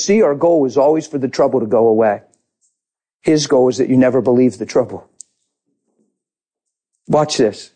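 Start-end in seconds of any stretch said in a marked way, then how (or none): none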